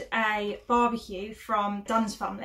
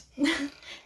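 short laugh; 5 semitones higher than usual. Real, not acted. Laughing at her own joke.

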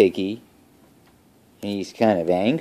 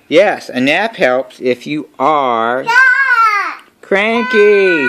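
slow build to a high, loud CRANKY; exasperated, bursting out on the word cranky; 9 semitones higher, 4 dB louder, slower than usual. A narrator's reading. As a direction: drag it out, then holler CRANKY. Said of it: "he says loudly".

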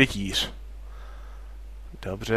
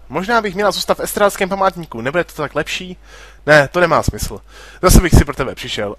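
ordinary talk, nothing marked

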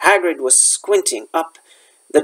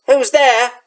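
higher-pitched challenge into the dark; calling out; 7 semitones higher than usual. A narrator's reading. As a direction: call out warily to a hidden stranger. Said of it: "Hagrid called".

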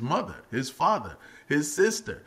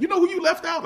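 mock reproach; 5 dB louder, faster than usual. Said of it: "I'd be like".

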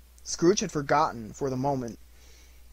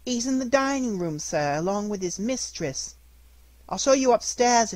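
put-on young servant girl, higher and polite; maid's voice; 8 semitones higher, 4 dB louder than usual. A narrator's reading. Servant girl answers Scrooge.

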